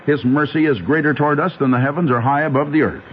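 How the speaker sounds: usual speaking voice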